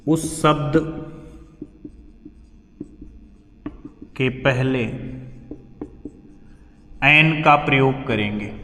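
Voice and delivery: word-by-word dictation pace. Acted. Teacher dictates rule for writing.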